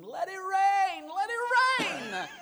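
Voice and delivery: high, slow, drawn-out shout; screaming. Retold or imitated. Teenage boy screaming his prayer for rain, high drawn-out shout. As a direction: cry it out to the sky, stretching each word. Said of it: "he began screaming".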